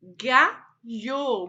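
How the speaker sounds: "Galho" in two loud, separated syllables; teacher splitting "Galho" in two. Shown as a demonstration. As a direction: sound out "Galho" firmly, pausing after the first syllable. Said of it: "por sílabas e monte as palavras".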